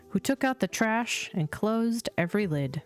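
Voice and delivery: ordinary delivery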